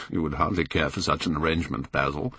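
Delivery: light amused reply after a laugh; a touch of laughter in the voice. A narrator's reading. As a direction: tease him through a chuckle. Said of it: "cried Lord Henry, laughing".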